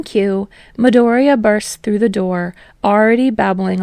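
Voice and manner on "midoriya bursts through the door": plain reading voice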